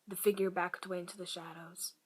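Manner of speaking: ordinary talk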